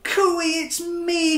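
high, loud little-fish call. Acted. Stanley calling out to his friends, high cheerful call. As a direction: sing out to find your friends. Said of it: "he called".

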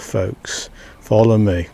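normal talking voice

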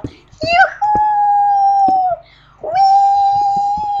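very high, loud squeal of joy; ecstatic squeal. Real, not acted. Gamer having a joy fit, squealing. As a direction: lose it completely over the loot. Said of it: "já mám záchvat".

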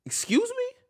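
squeaky high "excuse me" in mock outrage; mock offended. Answering people who mock his crying, with a mock offended squeak. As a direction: shoot the pitch way up, faking offense.

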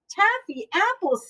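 high-pitched echo, dropping at the end; lightly voiced Missus Rogers. A narrator's reading. Missus Rogers echoing the request for taffy apples, lightly voiced. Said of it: "said Missus Rogers".

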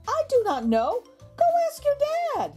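usual speaking voice

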